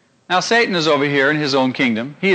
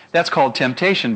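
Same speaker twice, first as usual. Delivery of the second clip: ordinary talk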